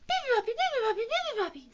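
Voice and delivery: very high, loud chirping; squeaky happy birdsong; cheerful baby bird. A narrator's reading. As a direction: chirp it out merrily like a tiny bird. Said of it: "said the baby Beebe bird cheerfully".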